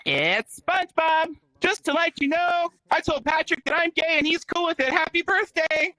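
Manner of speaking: high-pitched cartoon character voice; SpongeBob. Birthday call to the comic's daughter, cartoon SpongeBob voice. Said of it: "she gets a phone call from SpongeBob".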